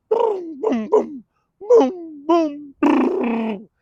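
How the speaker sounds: high loud booming gunfire noises; mock gunshot booms. Kids shooting at him, mock gunfire booms. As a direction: fire off the booms like shots.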